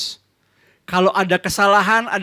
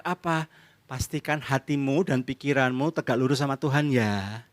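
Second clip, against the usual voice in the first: ordinary talk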